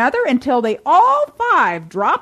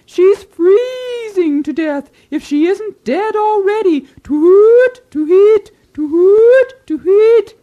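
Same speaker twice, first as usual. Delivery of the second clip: much higher, loud hooting gossip; excited owl gossip; 10 semitones higher, 7 dB louder than usual. Acted. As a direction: shriek the scandal between hoots. Neighbor owl spreading scandal.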